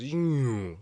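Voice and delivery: loud falling yoo; passing whoosh. Retold or imitated. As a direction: sound it dropping as it passes. Imitating a sound passing by.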